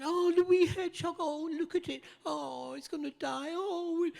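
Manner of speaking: high mock cooing over the hedgehog; imitating cooing fans. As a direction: coo like a crowd melting over a baby animal. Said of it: "everybody was going".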